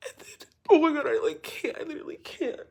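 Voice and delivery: real tearful overwhelm; choked up. Real, not acted. Storyteller overcome recalling the message, choked up. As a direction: break off, too moved to go on.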